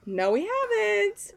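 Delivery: high, soft, drawn-out mock whimper; mock tearful. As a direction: imitate a tearful reply, softly and slowly.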